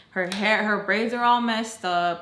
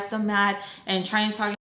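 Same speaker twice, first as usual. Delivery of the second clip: ordinary talk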